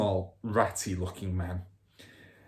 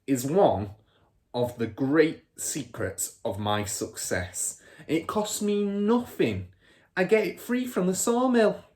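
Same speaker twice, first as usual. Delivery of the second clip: boastful dad, pitched up and pleased with himself; father's voice; 7 semitones higher, 4 dB louder than usual. Acted. Father bragging to Matilda, boastful and raised. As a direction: crow about the trick like a cheap crook.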